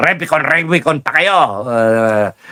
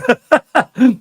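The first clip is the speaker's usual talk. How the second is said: high laugh; 10 semitones higher than usual. Real, not acted. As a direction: burst out laughing. Laugh alone on line. (malakas na tawa)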